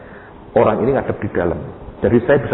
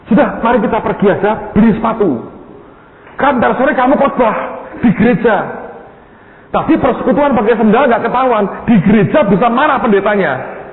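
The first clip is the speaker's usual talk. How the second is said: high-pitched, raised-voice urging; loud, high pleading; urgent persuading; 12 semitones higher, 3 dB louder than usual. Retold or imitated. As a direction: argue your friend into coming along, high and insistent. Acted urging of shoeless preacher friend.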